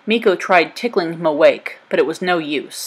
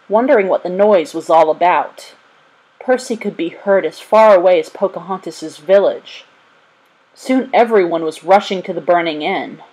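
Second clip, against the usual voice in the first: plain reading voice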